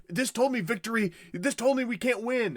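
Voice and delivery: high, whiny mock despair; comic high-pitched bleating; distraught protest. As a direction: wail the complaint like a man betrayed. Acted Denethor protesting with Palantir.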